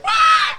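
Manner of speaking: high piercing mock shriek, laughing; mock Nazgul shriek. A kid recalling the Nazgul, with a mock shriek. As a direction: screech like a Nazgul diving at you. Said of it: "Nazgul just coming at you like".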